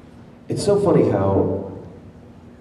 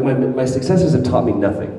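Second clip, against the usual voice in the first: normal talking voice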